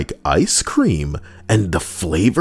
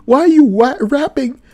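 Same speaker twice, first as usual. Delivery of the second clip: much higher, alarmed mock squeal; panicked friend; 3 dB louder than usual. Buddy alarmed at the wet-towel bat, high panicky voice.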